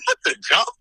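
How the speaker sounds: very high astonished squeal; retold surprise at the car suddenly running; stunned disbelief. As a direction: squeak it out in shock as the engine starts. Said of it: "I was like".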